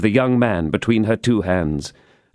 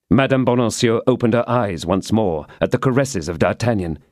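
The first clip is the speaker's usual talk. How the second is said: usual speaking voice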